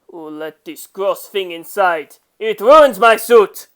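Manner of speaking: disgusted, rising to a loud complaint; furious over the spider; angry outburst. Acted. Disgusted man finds spider on suit.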